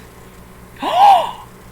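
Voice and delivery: loud high gasp; loud outraged gasp; shocked gasp. Real, not acted. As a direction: gasp in disbelief. Account required, shocked.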